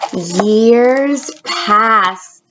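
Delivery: ordinary delivery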